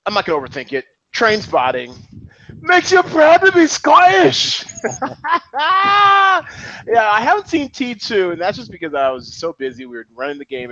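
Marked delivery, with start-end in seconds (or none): 2.6-4.6 put-on high, loud, drawn-out Scottish accent; mock Scottish rant. Quoting the Trainspotting rant, loud mock Scottish accent. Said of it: "he goes on this great rant". 4.7-6.8 high, long cackling laugh. Real, not acted. After mimicking the film line, high laughter.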